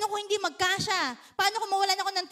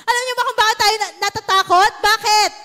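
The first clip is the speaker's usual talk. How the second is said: rising loud preaching; 4 semitones higher, 9 dB louder than usual. Real, not acted. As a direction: build to a loud question. Preaching climax. (malakas na pangangaral)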